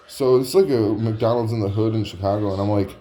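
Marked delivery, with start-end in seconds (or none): none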